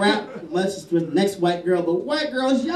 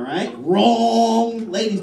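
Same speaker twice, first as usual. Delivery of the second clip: ordinary talk, nothing marked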